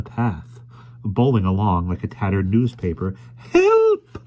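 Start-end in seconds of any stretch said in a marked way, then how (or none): 3.4-4.2 very high, loud shriek for help; shrill cry. A narrator's reading. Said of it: "shrilled a familiar voice".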